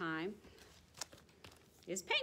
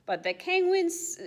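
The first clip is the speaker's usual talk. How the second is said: a hint of a higher amused zookeeper; 10 semitones higher, 5 dB louder than usual. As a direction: tease about the penguins. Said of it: "says the zookeeper".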